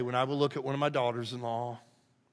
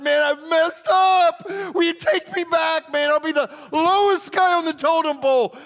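much higher, much louder pleading shout; begging cry; 14 semitones higher, 10 dB louder than usual. Retold or imitated. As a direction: cry out like a desperate son. Prodigal son begging father.

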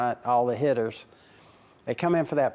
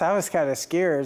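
ordinary talk

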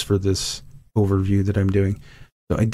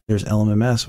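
ordinary delivery

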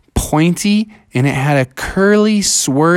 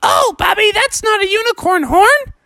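squeaky, very high sea-creature voice; friendly magical creatures in high falsetto; narwhal chorus. Acted. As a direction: reassure him in a tiny falsetto. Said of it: "And they all said".

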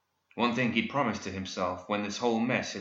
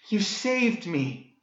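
high, slow swooning damsel; breathed heroine; 10 semitones higher, much slower than usual. A narrator's reading. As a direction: sigh it out as a rescued romantic heroine. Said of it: "Daphne breathed".

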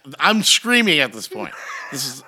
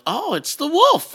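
the wolf arrives; 11 semitones higher, slower than usual. Retold or imitated. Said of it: "and I'm like".

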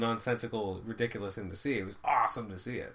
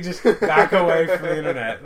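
laughing; 4 semitones higher, 13 dB louder than usual. Retold or imitated. Quoting Affleck on a talk show.

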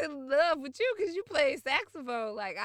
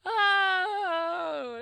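excited cry; 7 semitones higher, 8 dB louder than usual. Real, not acted. Cry after insider joke.

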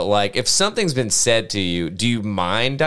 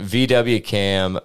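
usual speaking voice

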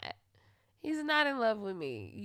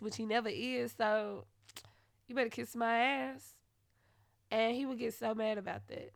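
normal talking voice

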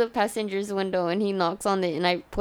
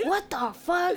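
high, startled outburst; a mock shocked what the fuck; shocked friends; 7 semitones higher, 6 dB quieter, slower than usual. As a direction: blurt it out in disbelief. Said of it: "they're like".

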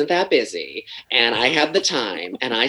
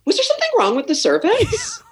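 high, sugary mock-innocent voice with a laugh; passive-aggressive mock politeness; fake-polite question. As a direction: waiter confronting customers about the tip, sweetly. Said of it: "I'm like".

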